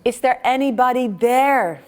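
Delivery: loud, high call into the dark; shouting again. Acted. Pilgrim on the cliff calls out again, loud high shout. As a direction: call out hoping someone hears. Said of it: "And he's shouting again".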